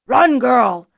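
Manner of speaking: sudden loud yell to flee; shouted warning. A narrator's reading. Archer warning Fiona away from the dog, loud shout. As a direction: bellow at the girl to run. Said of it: "someone shouted".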